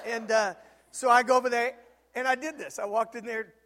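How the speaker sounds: ordinary talk, nothing marked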